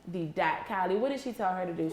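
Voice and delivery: ordinary talk, nothing marked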